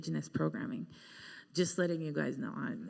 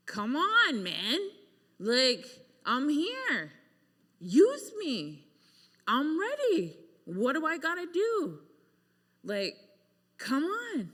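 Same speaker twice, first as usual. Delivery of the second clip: raised, higher pleading at the sky; angry plea; 8 semitones higher, 4 dB louder, slower than usual. Retold or imitated. Shouting up at the night sky in the mountains, angry pleading. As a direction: demand it of the heavens, frustrated. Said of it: "I was angry".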